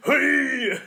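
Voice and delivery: loud, high mock-Japanese samurai shout; drawn-out caricature Japanese cry. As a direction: bellow like a stock Japanese samurai character. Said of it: "my racist Japanese voice sounds like".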